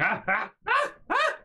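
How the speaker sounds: high panicked cries; panicked yelps. Retold or imitated. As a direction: yelp while jamming the brake. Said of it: "I'm like".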